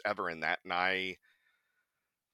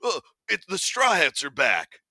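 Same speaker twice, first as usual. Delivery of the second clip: higher, louder cartoon henchman bark; goon henchman voice; 9 semitones higher, 8 dB louder than usual. Recalling his Fish Rider goon line, a louder henchman voice. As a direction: announce it like an eager thug sidekick. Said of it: "I was his right-hand man".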